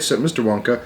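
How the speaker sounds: usual speaking voice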